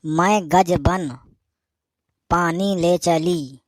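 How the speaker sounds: very slow, drawn-out words with pauses; slow dictation. Acted. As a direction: dictate it slowly for someone writing it down. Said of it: "धीरे धीरे बोल, लिखने पे". (धीरे-धीरे बोलकर लिखवाना)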